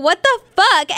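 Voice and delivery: high, loud, overwhelmed disbelief; about to cry. Retold or imitated. Girlfriend astonished by boyfriend's kindness, nearly crying. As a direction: exclaim it as if tears are coming. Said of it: "I'm gonna start crying".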